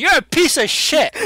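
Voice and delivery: hammed-up Batman scolding; mock accusation. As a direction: jab the finger and spit it out slowly. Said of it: "pointing at people saying".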